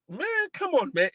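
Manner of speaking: high-pitched exclamation; exasperated appeal. Real, not acted. Appealing to fellow teachers, exasperated high-pitched plea.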